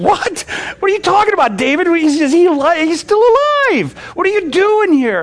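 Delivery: high, loud astonished outburst; the shocked men blurting out at David's return; stunned disbelief. Acted.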